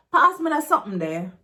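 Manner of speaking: quick, pushy Jamaican Patois demand; aggressive. Shown as a demonstration. Teacher contrasting a polite request, aggressive Patois. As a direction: fire it off as a brusque order.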